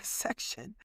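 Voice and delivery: normal talking voice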